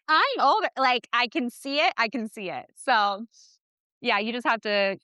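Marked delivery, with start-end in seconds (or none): 0.1-0.7 higher-pitched imitation of a bragging older twin; boasting child. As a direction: mimic a kid pulling rank, pitched up. Voicing boastful older twin son.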